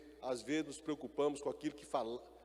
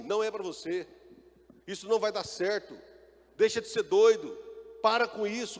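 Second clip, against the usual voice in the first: discouraging voice; 4 semitones higher, 6 dB louder than usual. Acted. Doubting voice discourages believer.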